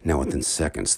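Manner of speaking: ordinary delivery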